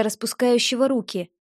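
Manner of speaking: ordinary delivery, nothing marked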